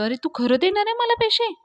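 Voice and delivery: high-pitched, delighted question; lifted, happily astonished voice; happy surprise. A narrator's reading. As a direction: ask it high, pleased and disbelieving. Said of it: "धन्या खुश होतो आणि म्हणतो".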